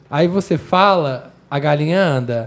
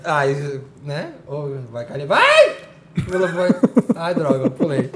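raging gamer; 3 semitones lower, 5 dB quieter than usual. Acted. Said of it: "Chicken Scream".

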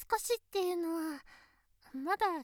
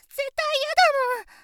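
put-on higher, louder childish insistence; loud protest; 6 semitones higher, 9 dB louder than usual. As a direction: stamp her foot with words. Insisting against breakup.

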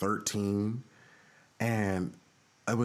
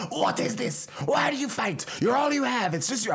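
put-on very high, emphatic Belizean-accented rebuke; dad's Belizean accent; 4 dB louder than usual. Dad scolding fighting kids, high Belizean-accented rant. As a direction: lecture loudly in a high-pitched Belizean accent. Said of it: "impression of Dad".

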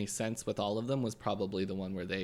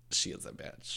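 whisper; 6 dB quieter than usual. Retold or imitated.

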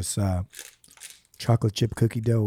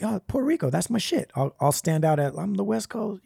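mock eager younger self; 6 semitones higher, 4 dB quieter than usual. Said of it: "and I'm like".

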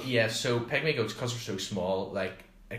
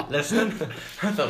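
laughing; 8 semitones higher, 5 dB louder, faster than usual. Real, not acted. Self-correcting mid-sentence.

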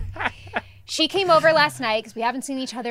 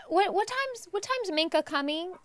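higher, softer mock-casual kid; playing it cool; 7 semitones higher, 6 dB quieter than usual. Mom imitates son asking when guest is coming, playing it cool. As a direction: imitate a boy feigning nonchalance, high and soft. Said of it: "like, playing it cool".

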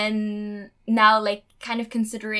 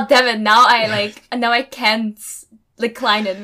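loud, frustrated Oh damn it; exasperated outburst; 9 dB louder than usual. Retold or imitated. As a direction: blurt it out as if trapped. Retold trapped reaction.

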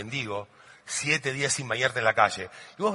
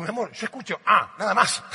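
higher-pitched protest to his wife; comic exasperated husband, acted; baffled husband; 5 semitones higher, 4 dB louder than usual.

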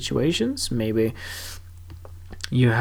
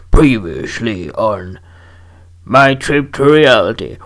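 slow, booming mock trailer voice; TV recap announcer; 2 semitones lower, 8 dB louder than usual. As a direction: parody a drama series' previously-on intro, drawn out and loud. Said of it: "It's not like it's friggin' Walking Dead".

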